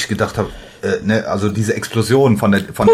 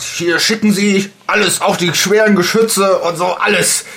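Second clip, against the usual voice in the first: shouting order; 10 semitones higher than usual. Retold or imitated. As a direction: yell it like a frantic officer. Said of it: "der ruft dann ... und sagt".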